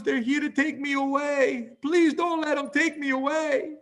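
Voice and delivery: very high, pleading panicked wail; acted high-pitched cry of fear; frightened grandmother. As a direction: imitate a terrified grandma begging for protection. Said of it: "She was full of fear".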